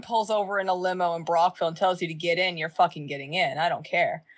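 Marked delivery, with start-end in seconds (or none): none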